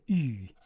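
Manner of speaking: "Ee", a fronted ooh held long; Baltimore "ooh". Shown as a demonstration. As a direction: push ooh forward until it sounds like "Ee". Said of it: "the Baltimore accent".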